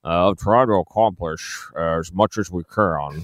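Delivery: slow, slurred politician impersonation; Mitch McConnell. Shown as a demonstration. Radio host showing off his impersonation against the clip, slow slurred impression. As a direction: drag the words out, slurring like the senator. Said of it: "my Mitch McConnell impersonation".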